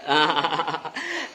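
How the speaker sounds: soft, low chuckle; laugh. Real, not acted. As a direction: chuckle softly. Laughing after chatting with the children.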